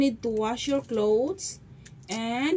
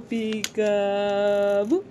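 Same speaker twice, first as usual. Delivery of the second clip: slow, louder sing-song peekaboo; drawn-out peekaboo; 3 semitones lower, 4 dB louder, much slower than usual. Pretend. Mom playing peekaboo with her baby girl, stretched sing-song.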